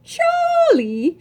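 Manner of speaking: high, rising cry of his name; a thrilled, soaring Charlie; delighted cry. A narrator's reading. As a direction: call out his name with joy. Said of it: "she cried".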